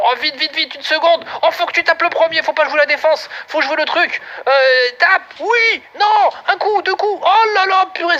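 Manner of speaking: high, urgent rush of game panic; yelling at the screen as the tower falls; frantic excitement. Real, not acted. Tense final seconds of a match.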